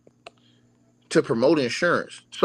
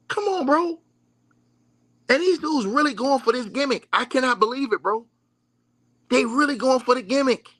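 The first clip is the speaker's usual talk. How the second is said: incredulous; 8 semitones higher than usual. Real, not acted. Incredulous rant at fans.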